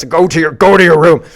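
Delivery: loud bossy parent command; mock parent order. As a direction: order them off like a cross parent. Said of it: "as if I told you to".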